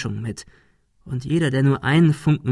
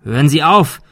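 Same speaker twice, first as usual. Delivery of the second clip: loud, higher shout to stop; urgent raised cry; calling out; 4 semitones higher, 6 dB louder than usual. A narrator's reading. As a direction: shout across at the gunman. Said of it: "rief Thomas".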